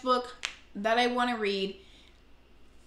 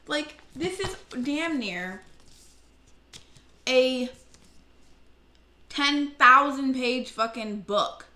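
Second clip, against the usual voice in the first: exasperated; 5 semitones higher, 5 dB louder, slower than usual. Real, not acted. Exaggerated complaint about tiny font.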